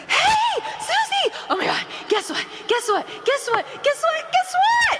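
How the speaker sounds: gossipy excitement. Shown as a demonstration. Trainer showing a bubbly caller excited to share gossip. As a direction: squeal it like you have juicy gossip. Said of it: "You need to sound like you have gossip".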